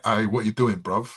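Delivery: casual London street voice; London accent. Acted. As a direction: ask it like a London bloke. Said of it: "that's like a London".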